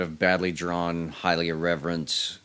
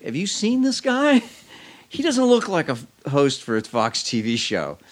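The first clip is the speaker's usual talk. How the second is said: incredulous buddy; 7 semitones higher, 4 dB louder than usual. Retold or imitated. Buddy who had seen him doubting he could host, incredulous. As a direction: retell a friend's disbelief, pitched way up and louder.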